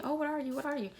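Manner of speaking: lightly mock someone prying; nosy questioner. People asking what she is, nosy mimicry.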